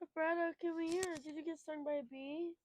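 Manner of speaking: high, soft voice for a pet; pet talk. Real, not acted. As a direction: coo gently to the dog. Calling her dog, worried.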